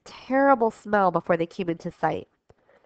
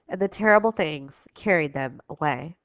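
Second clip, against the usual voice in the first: plain reading voice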